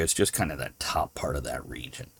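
soft unvoiced whisper; whispered aside. Real, not acted. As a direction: whisper it as a side note. Quick aside to listeners.

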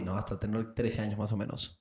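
ordinary talk, nothing marked

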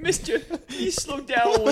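put-on very high, slow pleading kid voice; cutesy baby talk. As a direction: whine it like a little child.

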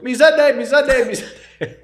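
high loud sing-song call. Pretend. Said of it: "someone goes".

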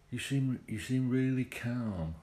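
half-hearted Cockney, drawn out; attempted Cockney accent. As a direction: have a shaky go at a Cockney accent. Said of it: "I can't do a Cockney accent".

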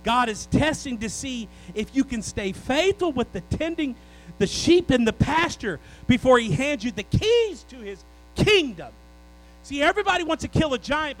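loud, driving pulpit cadence; preaching at full force. Acted. Pastor urging the church to stay faithful, loud preaching. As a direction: preach it out hard to the whole congregation.

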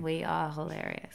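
soft, level monotone boast; deadpan monotone. Shown as a demonstration. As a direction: say it with no excitement at all. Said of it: "Like my monotone".

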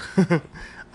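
mock dragon chuckle; a friendly hammed-up laugh. Dragon laughs at Rory's joke, mock chuckle. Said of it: "The dragon chuckled".

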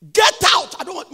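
loud, high shout of dismissal; acted angry shout. Mother throwing her daughter out, acted angry shout. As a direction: shout them out of the house.

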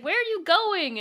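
high-pitched indignant mock cry; mock calling after. Pursuer to fleeing woman, mock high-pitched cry. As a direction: call it after someone running off.

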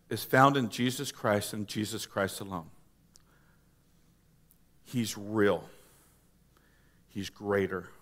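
low, quiet, grave sermon delivery; low-voiced solemn plea; hushed earnest preaching. Real, not acted. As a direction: drop low and soft, pleading with the congregation.

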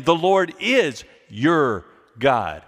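"The Lord is your God" said slowly, each word weighted; slow down and weigh each word, leaning on "is"; slow emphatic quote. Shown as a demonstration. Pastor quoting Jesus to the congregation, slow and weighted. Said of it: "My emphasis is on your".

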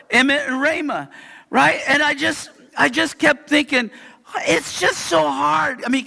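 ordinary delivery

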